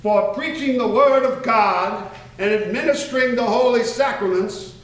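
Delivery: grand formal read of a royal decree; officious proclamation. Shown as a demonstration. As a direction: proclaim it like a herald before the crown. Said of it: "make this as officious as I can".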